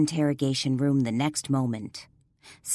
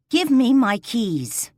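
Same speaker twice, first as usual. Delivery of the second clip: slow, higher, loud firm command; loud demand; 7 semitones higher, 6 dB louder, slower than usual. Acted. Woman demanding her keys from the sergeant, loud and slow. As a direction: plant each word, make him hand them over.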